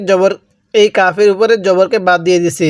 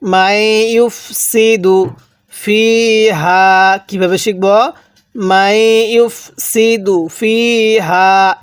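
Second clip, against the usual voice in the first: slow drawn-out tajweed chanting; slow recitation; much slower than usual. Shown as a demonstration. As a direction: recite slowly for students to echo. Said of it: "হ্যাঁ, পড়ুন".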